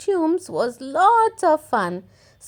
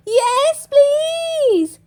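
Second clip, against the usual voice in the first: high, eager cheer; cheering kids; 13 semitones higher, 4 dB louder than usual. Acted. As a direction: cheer it like excited piglets. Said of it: "cheered Peppa and George".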